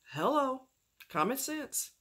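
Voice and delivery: like it should be obvious; exasperated. Real, not acted. Exasperated exclamation.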